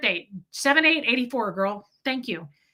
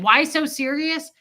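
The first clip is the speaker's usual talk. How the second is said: put-on higher, louder Brooklyn accent; pitched-up Brooklyn comeback; 6 semitones higher, 5 dB louder than usual. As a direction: echo it back in mock Brooklyn. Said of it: "I switched it up to a Brooklyn accent".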